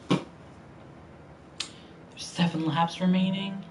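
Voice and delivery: ordinary talk, nothing marked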